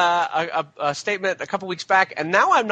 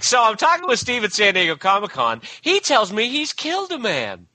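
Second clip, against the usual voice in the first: higher, eager podcast tale stretching into the punchline; mock-breathless gossip; 4 semitones higher than usual. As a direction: dish a scandal with pretend excitement. Imagined scandalous podcast retelling.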